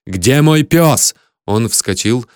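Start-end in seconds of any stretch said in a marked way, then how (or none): 0.0-1.1 high, loud, drawn-out demand; furious shouted demand; angry yell. A narrator's reading. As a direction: bellow the question slowly. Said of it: "заорал я".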